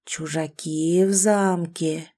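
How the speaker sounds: hollow, low, slow ghost voice; cold hissing layered whisper. Acted. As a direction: drag out the words like a ghost. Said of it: "глухим загробным голосом, растягивая слова".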